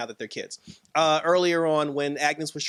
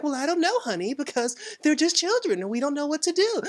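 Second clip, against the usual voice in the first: high-pitched imitation of a TV wife; Wanda; 11 semitones higher than usual. Wanda pleading ignorance about the babies to her husband, high imitation. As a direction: put on a much higher wifely voice. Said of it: "And she's like".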